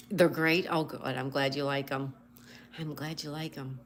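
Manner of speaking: ordinary delivery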